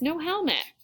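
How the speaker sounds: put-on high, insistent kid's protest; emphatic high-pitched objection, not shouted; forceful refusal. A narrator's reading. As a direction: refuse firmly as a stubborn girl. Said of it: "Rosa says it so forcefully".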